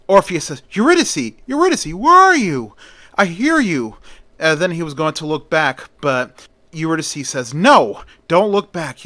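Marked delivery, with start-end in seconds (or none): 0.7-4.0 high, loud, searching cry for her; desperate calling out. A narrator's reading. Orpheus calls out to unseen Eurydice. 7.5-9.0 urgent warning. A narrator's reading. Eurydice urgently warns Orpheus.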